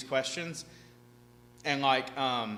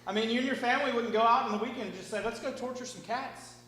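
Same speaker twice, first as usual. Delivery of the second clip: mock activist voice; 8 semitones higher than usual. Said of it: "They're like".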